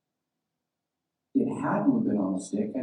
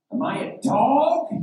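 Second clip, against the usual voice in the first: loud, high indignant roar; 13 semitones higher, 6 dB louder than usual. Acted. Goliath to David before their fight, roaring. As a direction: roar it out like an insulted giant. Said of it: "he roared at David".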